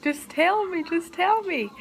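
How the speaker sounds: high, pleading just tell me; pleading friend. Retold or imitated. Friend pleading while hugging her.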